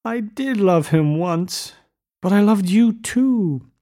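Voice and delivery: high, slow, tearful narration; mock sobbing. A narrator's reading. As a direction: plead as if close to helpless sobs. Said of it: "She began to sob helplessly".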